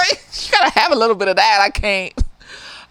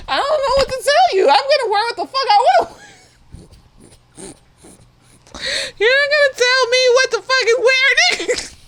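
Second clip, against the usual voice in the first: defiant; 10 semitones higher than usual. Acted. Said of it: "defiant rant".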